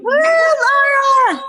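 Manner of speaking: loud high whoop; cheering shout. Real, not acted. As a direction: cheer her on from the crowd. Cheering a friend on stage.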